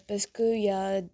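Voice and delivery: ordinary delivery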